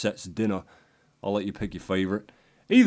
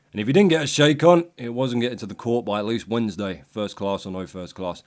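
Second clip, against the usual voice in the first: normal talking voice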